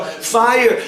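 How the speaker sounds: acted warning cry; alarm call. Acting out villagers' alarm call.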